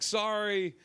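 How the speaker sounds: mild mock yell, no real volume; retold yelled apology. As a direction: imitate an apology called across a room. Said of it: "like, yelled to me".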